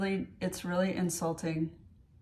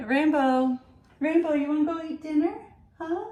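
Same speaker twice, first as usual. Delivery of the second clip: talking to a dog; 8 semitones higher, 4 dB louder than usual. Real, not acted. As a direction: coo at the puppy. Calling to her dog.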